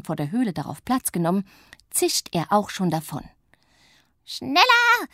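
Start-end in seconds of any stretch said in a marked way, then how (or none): 4.2-5.1 very high, loud whoop; wild shout. A narrator's reading. Witch on the racing sledge screams for more speed, wild. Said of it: "schreit Pumpernickel".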